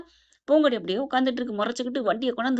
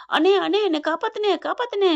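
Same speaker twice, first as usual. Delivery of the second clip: high, pitched-up plea to be saved; mock scream for rescue, voice raised high; crying out for help; 8 semitones higher, 4 dB louder than usual. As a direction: act out a panicked cry to a brother. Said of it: "ராசாத்தி கத்துது".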